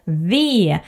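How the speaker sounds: ordinary talk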